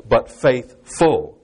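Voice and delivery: very slow "faithful", split as faith, full; emphasised faithful. Shown as a demonstration. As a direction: stretch "faithful", weighting both halves. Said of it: "as the word really means".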